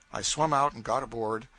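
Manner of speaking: plain reading voice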